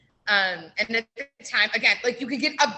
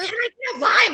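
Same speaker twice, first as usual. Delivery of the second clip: high, loud rising plea; last try for a lime, begging; high loud begging question; mock whiny customer; 10 semitones higher, 7 dB louder than usual. Re-enacting demanding customer.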